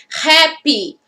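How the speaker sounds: "Rap" said as English "happy", H breathed; aspirated H. Shown as a demonstration. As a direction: start "Rap" on a puff of air, as in happy. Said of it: "Se eu escuto esse R". ("Rap" com som de happy, H aspirado)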